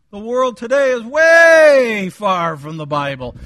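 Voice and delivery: "way" high, shouted and stretched long; stress on WAY. Real, not acted. Preacher stressing how far world moved.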